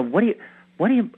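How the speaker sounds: usual speaking voice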